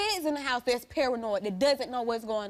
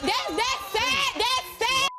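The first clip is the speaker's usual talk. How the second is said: much higher, pained "that's sad"; indignant outburst; 10 semitones higher, slower than usual. Real, not acted. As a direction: throw the words back at her, hurt and angry. Heated onstage confrontation.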